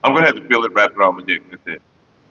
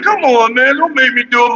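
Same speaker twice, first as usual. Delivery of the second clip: pleading; 9 semitones higher than usual. Retold or imitated. Begging his own dick, high and whiny. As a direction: beg him not to. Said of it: "I'm like".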